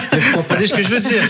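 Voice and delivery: amused chuckle while explaining; laughing. Real, not acted. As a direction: laugh through the words after the joke. Laughs at own Canadian accent remark.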